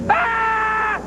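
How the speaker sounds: sudden high yelled "Ah!" as he charges; a high startled war cry before a tackle; battle cry. Retold or imitated. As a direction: let out a wild charging cry. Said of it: "When Eric goes".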